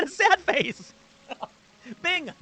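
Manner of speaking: high, laughing through the words; loud, high giggly outburst; amused laughter. Real, not acted. Laughs at the sad chatbot.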